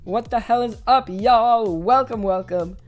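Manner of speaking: normal talking voice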